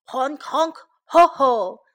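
honking mock laugh; loud, spoken ho-ho of a goose; gander's laugh. A narrator's reading. Gander laughs at the hedgehog's hat, mock laughing. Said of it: "the gander laughed".

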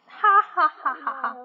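loud gloating cackle; hammed-up evil wolf laugh; villain laugh. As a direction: laugh like he's already won. Wolf gloats over prey.

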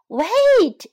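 high, loud chick's cry; shout. A narrator's reading. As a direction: shout to halt the fox. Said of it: "shouted Pip".

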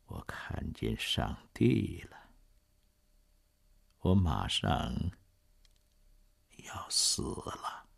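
slow fading whisper; last breath of a dying man; softly. Acted. Dying Jean Valjean to Cosette, slow fading whisper. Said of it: "嘴里只是轻声地说".

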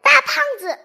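put-on high, loud, cheeky girl's voice; a mischievous girl tattling with relish; cheeky Miss Trouble. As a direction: fling the insult out with glee. Miss Trouble names the insult.

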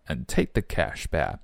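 "and take" with the d of "and" left silent; dropped final D. Shown as a demonstration. Teacher shows students the unread d, dropped in "and take". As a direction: say "and take the cash back", swallowing the d in "and". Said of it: "And的不读出来".

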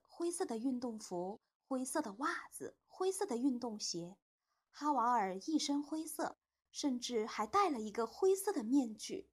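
usual speaking voice

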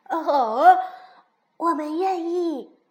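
loud, high cheer; children cheering in delighted agreement; happy. A narrator's reading. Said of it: "高兴地说". (高兴地大声欢呼)

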